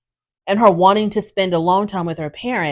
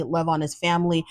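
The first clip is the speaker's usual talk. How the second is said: ordinary talk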